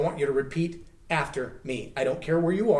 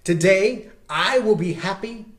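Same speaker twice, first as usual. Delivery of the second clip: slow, louder, long pauses between lines; slow call-and-repeat; 3 dB louder, slower than usual. Shown as a demonstration. A speaker leading standing viewers in repeating it, slow. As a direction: leave room for them to echo. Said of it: "Stand up and say these words".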